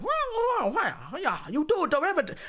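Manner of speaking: high, swooping caricature voice; mock it in a silly put-on voice. Streamer mocking hardcore math, silly caricature voice.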